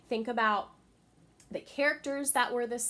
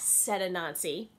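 ordinary delivery

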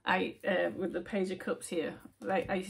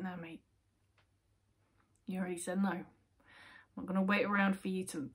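firm no-nonsense brush-off; retold telling off; 5 dB louder than usual. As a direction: send him packing. Telling off twin flame in dream.

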